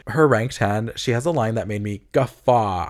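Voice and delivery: ordinary talk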